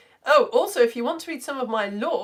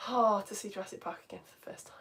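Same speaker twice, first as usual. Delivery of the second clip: whisper; 10 dB quieter than usual. Acted. Wistful aside about Jurassic Park.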